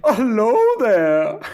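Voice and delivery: put-on a playful German accent, drawn out; Flula Borg accent. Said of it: "take it kind of in a Flula Borg direction".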